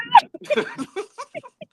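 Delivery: big high cackle; high laugh. Real, not acted. Laughing at her own party joke, a high loud cackle. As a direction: burst out laughing.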